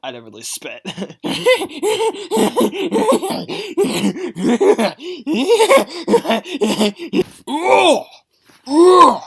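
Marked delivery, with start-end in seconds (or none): 0.9-7.2 high zombie cackle; long high creature laugh; creepy cackle. Acted. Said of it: "special infected impressions". 7.5-9.2 infected cries. Acted. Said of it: "special infected impressions".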